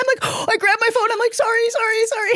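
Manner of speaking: high, hurried panicked sorries; flustered apology. Retold or imitated. Music blasting in the shop.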